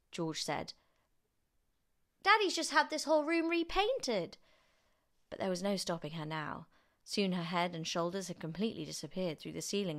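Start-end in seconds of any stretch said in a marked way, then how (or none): 2.2-4.4 put-on high, loud boy's voice; protesting boy. A narrator's reading. George protests to grandma about the damage, high boyish protest. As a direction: object in a high child's voice.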